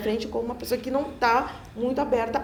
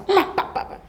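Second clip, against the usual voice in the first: rapid-fire imitated popping syllables; rushed; 12 semitones higher than usual. Shown as a demonstration. Southerner showing how hurried we are, rushed. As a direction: fire the syllables out in a high burst. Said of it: "a gente é muito apressado".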